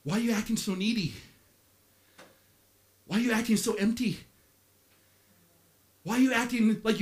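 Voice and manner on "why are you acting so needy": usual speaking voice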